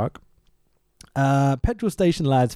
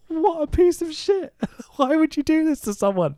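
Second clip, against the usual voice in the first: high-pitched indignant rant with a laugh in it; exasperated, getting angry; 13 semitones higher than usual. Real, not acted. Ranting to co-host about a callous character, half-laughing outrage. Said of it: "I'm getting too angry".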